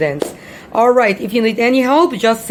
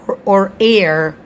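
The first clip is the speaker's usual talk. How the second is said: 1 American accent; 2 semitones lower than usual. Shown as a demonstration. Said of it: "in the American accent".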